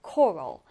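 "coral" with the stress on its first syllable. Shown as a demonstration. Teacher modelling a vocabulary word, stress on first syllable of "coral". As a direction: hit the opening "co" of "coral". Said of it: "첫 음절의 강세 두고".

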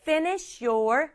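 "finish your" slowly joined as one unit, fini-shyour; linked words. Shown as a demonstration. Teacher showing easier pronunciation, finish your linked together. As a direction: glide from the sh of finish straight into your. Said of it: "요렇게 발음하면".

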